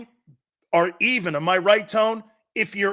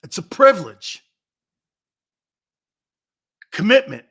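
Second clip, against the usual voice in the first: emphatic declaration; 3 semitones higher, 7 dB louder than usual. Real, not acted. Hammering home his point.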